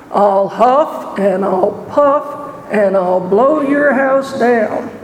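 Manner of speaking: slow, menacing wolf threat; big bad wolf voice. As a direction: draw it out like a wolf.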